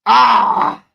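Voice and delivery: sharp loud jolted "ah", a real startled yell; jolted cry. Real, not acted. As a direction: shout like the smelling salt just hit. Sniffs smelling salt, jolt.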